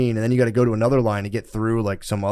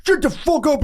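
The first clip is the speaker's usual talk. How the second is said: much higher mock yell; imitated spazzing outburst; 3 dB louder than usual. Teller replays irate man snapping at Brittany, high mock outburst. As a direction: blurt it in a hammed-up high freak-out. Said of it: "just started spazzing out".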